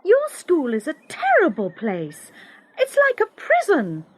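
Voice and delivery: high, upset outburst; angry. Acted. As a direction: lash out close to tears. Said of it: "Suddenly I was very angry".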